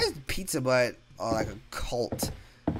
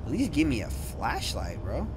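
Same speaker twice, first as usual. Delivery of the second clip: ordinary talk, nothing marked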